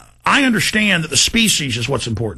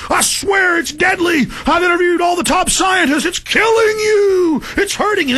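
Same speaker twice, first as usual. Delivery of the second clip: desperate pleading; 12 semitones higher than usual. Retold or imitated. Pleading with dismissive people, high desperate shout. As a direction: beg them to believe you, yelling. Pleading warning in retold scene.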